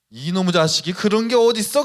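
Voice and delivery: retold teacher's angry outburst. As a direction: scold the kid like a furious teacher. Angry teacher before beating.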